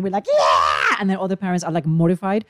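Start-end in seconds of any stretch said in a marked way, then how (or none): 0.3-1.0 re-enacted celebratory yell; sudden drawn-out whoop; cheering shout. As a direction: cheer like a parent at match point. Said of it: "every time Sabella makes a point".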